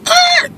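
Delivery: short, very high squeal; disgusted yelp. Retold or imitated. Kissing her neck and hitting a smell, disgusted yelp. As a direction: let out a shrill little yelp of disgust.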